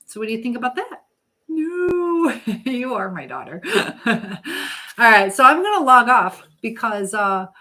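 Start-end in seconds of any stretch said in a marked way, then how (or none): 1.5-2.4 high, drawn-out "no"; a long high-pitched mock objection; playful protest. Real, not acted. Playful protest to viewer's reply. 2.5-5.3 amused, laughing as you speak; words mixed with chuckles. Real, not acted. Laughing while insisting on the joke.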